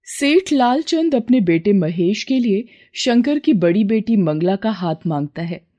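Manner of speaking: ordinary delivery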